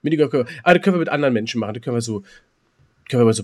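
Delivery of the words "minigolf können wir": ordinary talk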